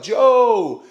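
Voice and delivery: high, loud imitated holler; calling out loudly. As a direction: shout the name as if from a turned-up phone. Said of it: "cranked up, like".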